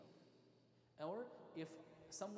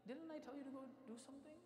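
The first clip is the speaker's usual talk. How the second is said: higher, softer questioning voice; soft gentle reminder; 8 semitones higher, 5 dB quieter than usual. Retold or imitated. Prophet to young servant caught playing, softly reminding. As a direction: imitate gently reminding a child. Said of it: "By him telling him softly".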